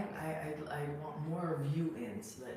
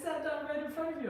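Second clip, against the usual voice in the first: usual speaking voice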